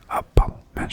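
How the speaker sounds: usual speaking voice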